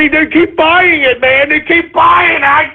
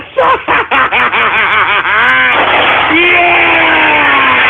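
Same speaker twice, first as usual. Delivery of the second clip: joyful laugh. Real, not acted. Laughing after cheering.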